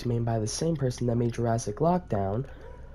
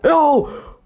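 sudden high startled cry; startled yelp; 14 semitones higher, 9 dB louder than usual. Real, not acted. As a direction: jump at the scare. Startled by ghost in game.

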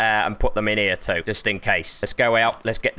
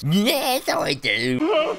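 much higher silly put-on voice; squeaky pirate voice; 14 semitones higher, much slower than usual. Bragging about the seven seas, high silly voice. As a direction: squeak it out like a cartoon sailor.

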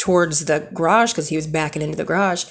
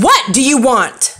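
angry demand; 6 semitones higher, 7 dB louder than usual. Retold or imitated. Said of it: "I said".

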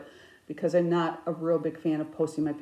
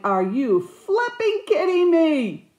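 slow, high, loud mock outrage; retold fury; 11 semitones higher, 7 dB louder, slower than usual. Crafter retelling her reaction to the framer, outraged. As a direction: replay the disbelief, stretching each word. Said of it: "I was so mad / I'm like".

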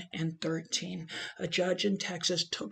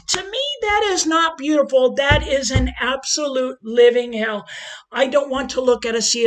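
higher and louder, building in passion; a fervent plea that climbs higher and louder; impassioned rising outcry; 5 semitones higher, 8 dB louder than usual. Real, not acted. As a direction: let the conviction swell into a near shout. Impassioned climax of speech.

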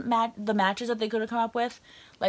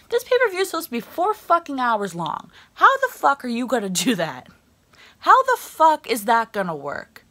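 raised, louder exasperated outburst; swearing in disbelief, higher and louder; angry rant; 4 semitones higher, 6 dB louder than usual. Real, not acted. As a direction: vent the outrage. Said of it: "Because I'm really mad".

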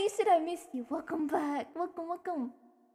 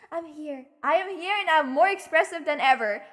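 ordinary delivery

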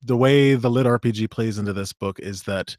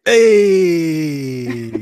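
excited; 5 semitones higher, 6 dB louder than usual. Real, not acted. Calling out excitedly.

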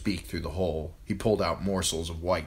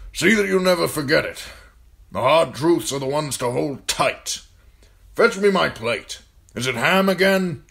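put-on raised, loud commanding old man; gruff old lord commander; 9 semitones higher, 9 dB louder than usual. A narrator's reading. Old commander ordering John to serve food, loud and gruff. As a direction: bark orders at the boy like an old soldier. Mormont voiced in story.

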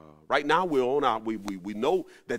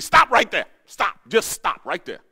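loud, higher burst that cuts someone off; riled-up shout; 3 semitones higher, 12 dB louder than usual. Retold or imitated. Friend shouting down the guy mid-prayer, loud and riled up. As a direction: slam the brakes on him, loud. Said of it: "when he kinda get it riled up".

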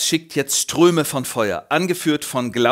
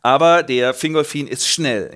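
ordinary talk, nothing marked

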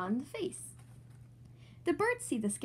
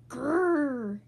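loud playful growl; 7 dB louder than usual. Acted. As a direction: growl like a little monster. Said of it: "says George".

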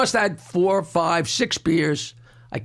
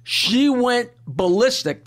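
ordinary delivery

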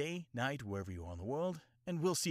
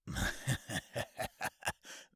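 breathy sinister laugh. Retold or imitated. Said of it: "anime impression video".

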